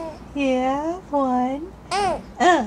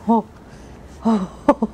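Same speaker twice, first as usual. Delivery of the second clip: usual speaking voice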